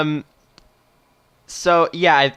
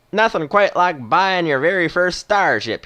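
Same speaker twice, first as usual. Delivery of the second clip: put-on slow, higher Western old-timer; slow Western movie accent; 6 semitones higher, 4 dB louder, slower than usual. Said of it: "doing a sort of Western accent".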